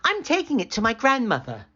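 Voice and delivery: put-on high, bright girl voice; girl tells the wolf about the soup. A narrator's reading. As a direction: answer like an innocent young girl.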